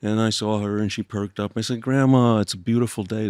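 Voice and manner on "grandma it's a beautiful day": louder, higher, upbeat call to a grandmother; bright cheerful greeting. Retold or imitated. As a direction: cheer her up as you walk in.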